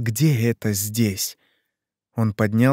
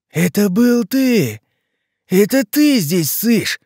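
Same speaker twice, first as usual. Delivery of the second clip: slow, high, loud monster accusation; slow furious accusation; 9 semitones higher, 5 dB louder, slower than usual. Acted. Leshy accusing Ivan of spying, slow high fury. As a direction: drag out each word in rage.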